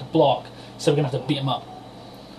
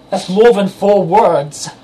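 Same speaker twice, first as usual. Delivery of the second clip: mock outrage; 4 semitones higher, 8 dB louder, slower than usual. Real, not acted. Mocking the rant's word count.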